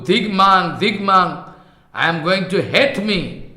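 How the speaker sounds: ordinary delivery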